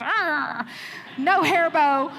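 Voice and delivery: mock growl of a defiant little girl; grumpy toddler. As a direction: growl it like a baby refusing a bow. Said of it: "And she was just".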